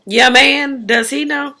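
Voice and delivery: high, prying question; nosy friend. Retold or imitated. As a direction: friend presses her about her man, pitched high. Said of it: "So the girl asked her".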